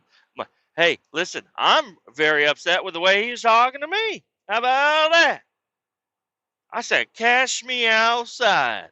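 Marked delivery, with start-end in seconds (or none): 0.8-5.4 indignant retort to mom. Retold or imitated. Arguing with mom on phone. 7.1-8.9 meme challenge. Retold or imitated. Quoting a meme in defiance.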